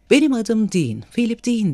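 high-pitched, jolly self-introduction; cheerful. A narrator's reading. Tipsy man cutting in to introduce himself, cheerful. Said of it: "dedi neşeli bir şekilde".

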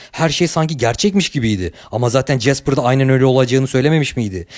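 plain reading voice

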